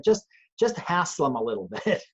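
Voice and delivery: real laugh slipping into the quote; amused, breaking into a small laugh near the end. Real, not acted. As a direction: say it through an unintended chuckle. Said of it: "I, I'm laughing".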